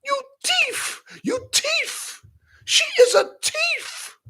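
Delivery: put-on high Nigerian accent, thief stretched. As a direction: accuse her in a Nigerian accent. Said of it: "That's how the Nigerians say it".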